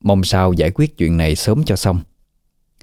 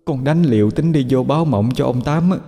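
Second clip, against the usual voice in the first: a lightly voiced ghost mother defending herself; ghost woman explaining; 7 semitones higher than usual.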